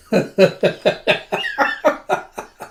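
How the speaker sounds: long, high, airy laughter; real cackling fit trailing off; laughing. Real, not acted. Reader amused by the jealous question, laughing.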